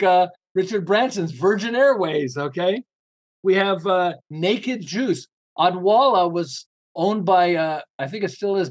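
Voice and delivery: usual speaking voice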